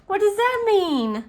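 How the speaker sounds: high, loud puzzled outburst; baffled. Real, not acted. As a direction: blurt it out in total confusion. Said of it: "I'm so confused".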